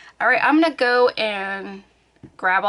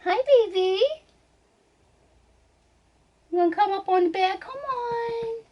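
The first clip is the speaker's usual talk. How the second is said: much higher sweet coaxing pet voice; 11 semitones higher than usual. Real, not acted. Calling her cat onto the bed in baby talk.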